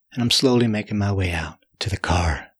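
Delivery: ordinary delivery, nothing marked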